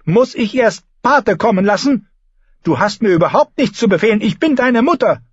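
high, vexed maternal rebuke; annoyed queen mother. A narrator's reading. Queen reminds her son who is mother, high and annoyed. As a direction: put the child in his place, pitched well up. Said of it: "schlug verärgert ins Wasser".

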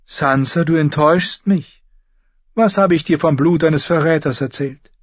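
disappointed queen. A narrator's reading. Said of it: "Die Königin seufzte".

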